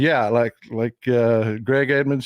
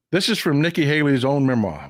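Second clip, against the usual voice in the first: ordinary talk, nothing marked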